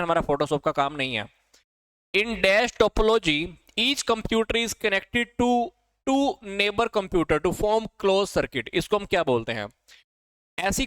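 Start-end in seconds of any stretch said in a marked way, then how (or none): 2.2-8.7 slow and a little higher, reading the question out; slow dictation. Shown as a demonstration. Reading a quiz question aloud. (छात्रों को धीरे-धीरे सवाल लिखवाना)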